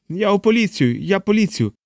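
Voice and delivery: high, pleading scared man; scared homeowner pleading innocence; frightened man. A narrator's reading.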